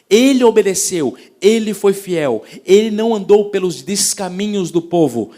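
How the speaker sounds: loud, slow, rising sermon cadence; full-force preaching. Acted. Pastor preaching to the church about Elijah, full force. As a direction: preach it out hard to the congregation.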